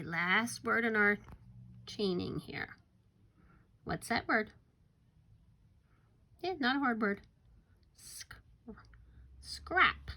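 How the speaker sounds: usual speaking voice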